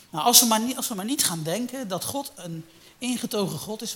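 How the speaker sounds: sudden loud rise into the warning; preaching climax. Real, not acted. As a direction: push it out like a warning from the pulpit. (luid waarschuwend gepredikt)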